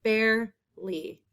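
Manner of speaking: Barely said slowly, each sound clear; slow modelling. Shown as a demonstration. Teacher slowly repeating a spelling word. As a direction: stretch out Barely for the class. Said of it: "That air sound".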